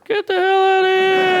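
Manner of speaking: high, loud, slow squeal of disbelief; mock disbelief. Comic to the crowd, high loud mock disbelief. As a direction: shriek it like you can't believe it.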